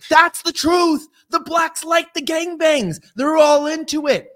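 high, loud, slow ranting voice; mock ranting bigot. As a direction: shout it like a raving crank. Mock outburst about gangbangs.

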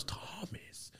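whispered name; imitating a whisper. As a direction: whisper his name in awe. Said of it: "He goes".

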